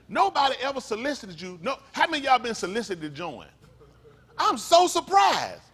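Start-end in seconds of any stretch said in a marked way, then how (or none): 4.4-5.6 much higher, louder, slowed mock astonishment; sarcastic surprise. Real, not acted. Preacher mocking the crowd's silence, slow exaggerated surprise. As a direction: drag it out with heavy sarcasm.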